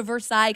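"Versailles" as "ver-SIGH"; wrong Versailles. Retold or imitated. Co-host quotes an outsider's invitation, ver-SIGH pronunciation. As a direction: say "Versailles" the French way, ending like "sigh". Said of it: "That's not right".

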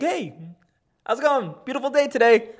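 high, breezy, exaggerated friendliness; mock cheery greeting. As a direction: sing out a carefree hello. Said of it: "And I was like".

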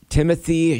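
"Timothy" as plain English TIM-uh-thee; anglicized first name. Shown as a demonstration. Host quizzing the co-hosts, anglicized first name. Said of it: "It's the Timothée that you got wrong".

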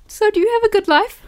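high laughing dad impression; imitating David. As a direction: quote him high through laughter. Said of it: "my favorite David quote is".

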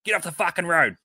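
high mock yell out a car window; retold yell. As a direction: bark it like an angry driver passing. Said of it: "he's yelled at the window".

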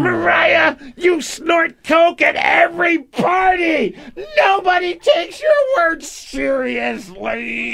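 hammed-up shrill accusation. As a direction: wail the accusation like a melodramatic coworker.

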